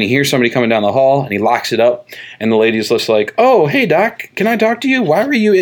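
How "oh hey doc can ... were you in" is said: high-pitched mock lady calling out; chirpy greeting. Lady catching the doctor in the hall, chirpy greeting. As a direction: put on a high, inquisitive woman's voice.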